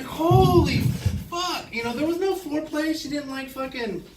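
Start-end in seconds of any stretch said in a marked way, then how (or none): 0.0-1.7 very high, loud, drawn-out exclamation; hammed-up shocked howl; stunned outcry. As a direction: cry it out, stretching the first word. Acts out shock of exam.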